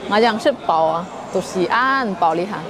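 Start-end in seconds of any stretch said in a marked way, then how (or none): none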